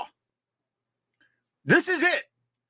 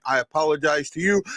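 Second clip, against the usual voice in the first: usual speaking voice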